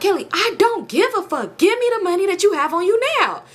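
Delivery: high, forceful imitated yelling; angry demand. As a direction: imitate him losing patience and demanding cash. Retold angry demand for money.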